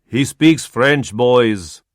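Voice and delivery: put-on loud, slow announcement to the press in an Eastern European accent; calling out. Zolly announcing to the press, called out. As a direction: call it out to the reporters. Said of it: "Zolly called out".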